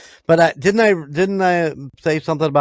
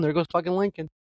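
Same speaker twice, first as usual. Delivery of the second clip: mock excited; 8 semitones higher, 4 dB quieter, faster than usual. People spotting ghost Lincoln.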